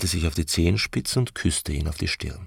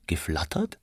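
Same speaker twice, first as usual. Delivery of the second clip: whispered, questioning repeat; hushed echo; 5 dB quieter than usual. A narrator's reading. Aneschka queries Franz's odd word, in a hushed echo. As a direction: softly whisper it back as a question.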